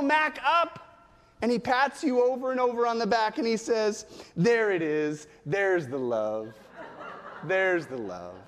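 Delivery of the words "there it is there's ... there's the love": low, slow mock DJ voice; deep radio DJ. Little boy patting a back during a hug, mock deep DJ voice. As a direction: drop it low and stretch out the last word. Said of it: "In a deep voice, like he's a radio D J".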